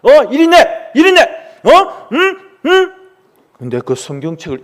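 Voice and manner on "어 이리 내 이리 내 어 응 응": high, loud snatching commands; angry demand. Acted. Father demanding the Bible from his son, loud angry commands. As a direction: grab it from him, furious. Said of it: "다시 이 아버지가 화를 내는 거예요".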